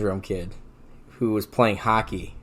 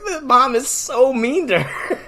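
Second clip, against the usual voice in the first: high, drawn-out words through laughter; wheezing with laughter; 13 semitones higher, slower than usual. Retold or imitated. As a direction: imitate being helpless with laughter. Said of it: "I'm wheezing".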